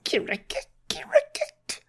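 high, creaky imitation frog call; frog croak. Frog croaking inside the told story.

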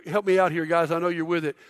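ordinary talk

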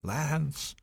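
a light imitation of a ghost calling a name; ghostly calling voice. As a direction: call his name like a spirit luring him in. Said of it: "this voice calling my friend's name".

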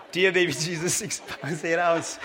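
lightly voiced goofy prayer to baby Jesus; Ricky Bobby prayer. Pastor quoting the movie to the congregation, mock prayer. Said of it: "Talladega Nights?".

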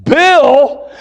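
high, disbelieving father; acted outraged double take; incredulous echo. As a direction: throw the name back in disbelief. Father echoes the name, incredulous.

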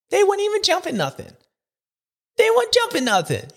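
high disbelieving cry; incredulous. Real, not acted. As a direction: act like you can't believe it. Incredulous complaint about slow racing.

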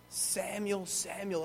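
soft, drawn-back call of a name; hushed calling voice. Acted. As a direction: call gently to a sleeper. Voice calls boy third time.

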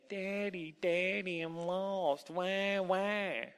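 high mock-crying singsong. A narrator's reading. Hidden elf imitates the boy's cries, mocking. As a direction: mimic a whining child to taunt him. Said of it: "heard a mocking voice say".

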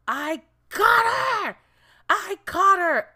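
high, loud, slow victory shout; triumphant cry. Retold or imitated. She catches the rat and yells in triumph, high slow shout. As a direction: shout it like you won. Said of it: "So I was just like".

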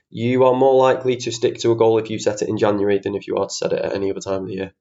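ordinary delivery

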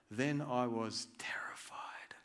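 hushed, slow whisper of fear; whispered dread; terrified whisper. Retold or imitated. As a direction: breathe it out like a frightened secret. Retelling fear in Nehemiah story.

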